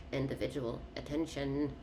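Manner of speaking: individual attention said quietly, trailing off; soft repeat. Shown as a demonstration. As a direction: murmur it to yourself. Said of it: "But individual attention".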